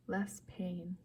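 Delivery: hushed, lulling repeat; soft coaxing. A narrator's reading. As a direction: let it fade away soothingly. Coaxing repeated, fading softer.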